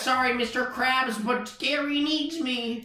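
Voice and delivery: high, plaintive cartoon voice; SpongeBob. SpongeBob refuses his boss for Gary, high plaintive voice. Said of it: "And he says".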